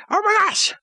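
usual speaking voice

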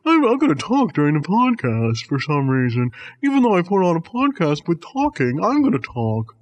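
dopey put-on voice of a rude listener; mocking rude listener. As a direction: mimic a clueless talker mockingly. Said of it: "That's you".

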